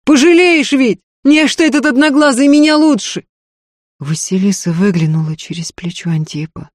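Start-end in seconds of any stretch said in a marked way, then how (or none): 0.0-3.2 high, raised, angry plea; angry shouting. A narrator's reading. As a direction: call furiously after the woman leaving. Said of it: "зло и жадно смотрел".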